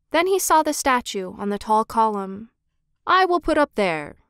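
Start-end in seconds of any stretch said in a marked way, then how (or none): 3.0-4.2 higher, mildly eager exclamation; eager cry. A narrator's reading. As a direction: call it out with a touch of eagerness. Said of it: "he cried".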